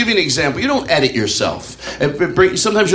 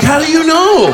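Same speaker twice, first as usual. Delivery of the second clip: high, loud mock alarm; alarmed reply; 12 semitones higher, 5 dB louder than usual. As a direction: squeal it like you've been found out. Caught being high, alarmed reply.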